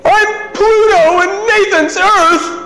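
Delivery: put-on high, slow, tearful child voice; sobbing child. Son tells dad at bedtime, high slow tearful child voice. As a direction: play the crying eight-year-old. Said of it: "he says".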